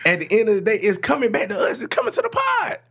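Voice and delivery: high, excited hype-man call; hyped-up boast. Acted. Announcing to cohost [name]'s return, high excited hype. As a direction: crow it out like a triumphant comeback. Hyping his return to the pod.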